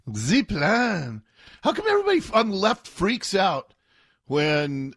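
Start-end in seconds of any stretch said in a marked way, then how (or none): 0.1-1.2 mock French zee plan; mock French accent. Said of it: "pull out a French accent".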